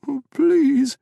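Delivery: high, loud desperate plea; frightened pleading. Acted. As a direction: beg for mercy. Terrified owner begging.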